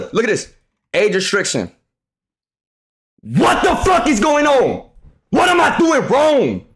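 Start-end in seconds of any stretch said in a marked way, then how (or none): 3.2-6.7 high-pitched, drawn-out outraged wail; real frustration, pitched way up and stretched; exasperated outburst. Real, not acted. Angry at being age restricted.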